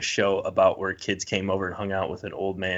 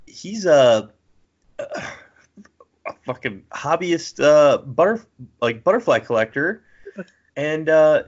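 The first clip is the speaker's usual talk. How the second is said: TV host voice; 7 semitones higher, 4 dB louder, slower than usual. Retold or imitated. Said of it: "they'll be like".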